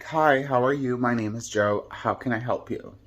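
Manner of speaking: ordinary talk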